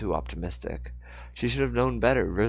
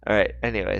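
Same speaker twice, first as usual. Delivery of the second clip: ordinary delivery, nothing marked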